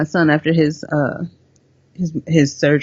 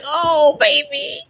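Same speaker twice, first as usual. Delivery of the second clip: tender coo; much slower than usual. Retold or imitated. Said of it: "I'm like".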